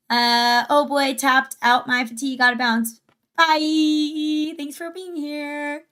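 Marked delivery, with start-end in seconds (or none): none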